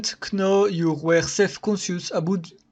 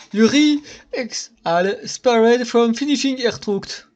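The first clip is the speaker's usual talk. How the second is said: ordinary talk, nothing marked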